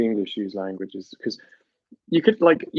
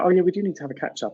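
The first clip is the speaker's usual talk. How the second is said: breezy empty promise; 9 semitones higher, much faster than usual. Retold or imitated. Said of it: "So it's like".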